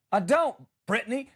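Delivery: usual speaking voice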